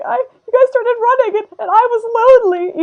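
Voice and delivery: high, loud protest in character; put-on plaintive character voice. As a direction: whine it out, pitched well up. Acts out Kaminari whining to friends.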